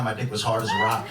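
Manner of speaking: normal talking voice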